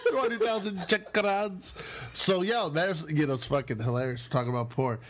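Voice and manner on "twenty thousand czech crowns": put-on laughing Czech accent. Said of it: "Brooklyn Buddha porn impression".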